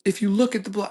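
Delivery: high-pitched mock debater; arguing customer. Customer rebutting his friend's point, argumentative. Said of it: "And then the guy's like".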